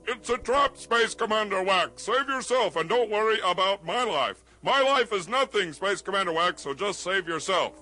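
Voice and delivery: high-pitched demo of a heroic warning; mock yell. Shown as a demonstration. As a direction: show your sidekick how to yell a noble sacrifice. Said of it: "you yell".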